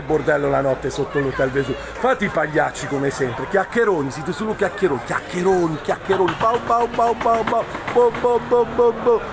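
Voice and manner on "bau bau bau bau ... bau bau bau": mock barking. Barking at rival fans.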